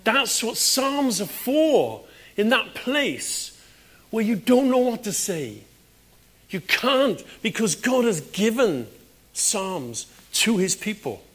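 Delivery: high, loud, drawn-out retold shout; shouting at radio. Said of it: "And I was shouting at the radio".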